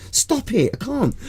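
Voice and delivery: very high-pitched whining protest; mock pleading. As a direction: plead to be let off, hammed-up and high. Acted protest at being forced.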